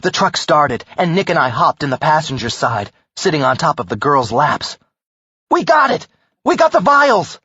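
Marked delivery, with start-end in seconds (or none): 5.5-7.4 high, thrilled cry of triumph; excited. Acted. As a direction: burst out with victory. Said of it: "I said".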